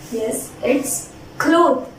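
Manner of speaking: very slow, drawn-out cloth; slow clear cloth. Shown as a demonstration. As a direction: stretch out cloth slowly. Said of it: "Let's pronounce this word".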